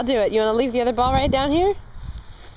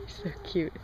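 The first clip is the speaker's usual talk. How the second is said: soft aside; 4 semitones lower, 14 dB quieter than usual. Real, not acted. Aside about cute toddler.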